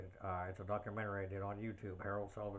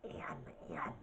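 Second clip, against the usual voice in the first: raspy, throat-made creature voice; throaty gravelly Gremlin; 4 semitones lower than usual. Shown as a demonstration. Impressionist shows viewers his Gremlins voice, throaty and gravelly. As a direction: croak it like a gravelly little monster. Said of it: "real throaty and, and, and, uh, gravelly".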